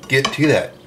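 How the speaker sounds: ordinary talk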